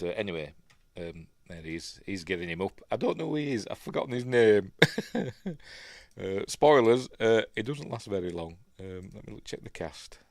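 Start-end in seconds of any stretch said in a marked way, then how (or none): 4.8-5.9 high-pitched chuckle; short real giggle; amused laugh. Real, not acted. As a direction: laugh at your own forgetfulness. Amused at forgetting the name.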